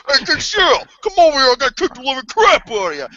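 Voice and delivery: high, loud taunting thug voice. Retold or imitated. Inmates taunting Kenshiro, in a hammed-up high thug voice. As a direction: jeer like a cocky street thug. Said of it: "they're kinda like that to Kenshiro".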